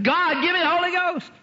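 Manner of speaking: high, urgent cry to God; acted fervent prayer. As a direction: plead it high, as re-enactment. Acts out her prayer.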